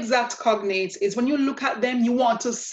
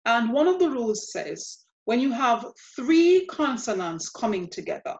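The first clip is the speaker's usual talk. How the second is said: ordinary talk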